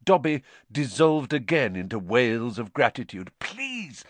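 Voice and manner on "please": high, hushed, urgent plea; frantic whisper. A narrator's reading. Harry hushing the wailing Dobby, frantic hushed plea. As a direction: plead softly, in a hurry to hush. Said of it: "Harry whispered frantically".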